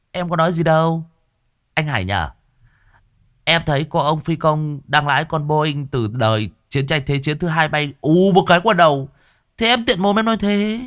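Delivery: high, mock-innocent cocky voice; cheeky smug reply. A narrator's reading. Long smirking back at the angry young man, mock innocent. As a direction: play innocent while taunting. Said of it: "thằng Long cũng vênh lên".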